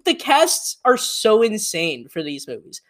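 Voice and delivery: ordinary talk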